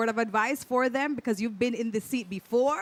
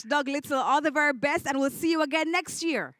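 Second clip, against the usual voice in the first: normal talking voice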